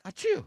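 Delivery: high, loud mock sneeze; fake sneeze. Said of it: "all of a sudden they're like".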